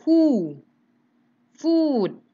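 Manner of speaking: who and food with rounded, pushed-out lips, loud; loud clear long oo; long oo demo. Shown as a demonstration. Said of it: "U-nya agak dimonyongkan".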